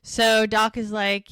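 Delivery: ordinary talk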